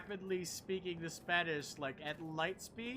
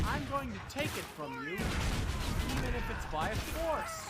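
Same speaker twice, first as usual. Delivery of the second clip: hammed-up menace, louder and higher, slowing at the end; mock villain taunt; 6 semitones higher, 5 dB louder, slower than usual. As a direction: play a cocky villain threatening the enemies. Taunting enemies in game.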